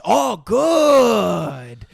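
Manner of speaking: put-on drawn-out, high Japanese-accented delight; exaggerated Japanese accent, loud and stretched; mock Japanese accent. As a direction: imitate an over-delighted Japanese-accented man. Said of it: "The voice is not offensive. You squinting your eyes absolutely is".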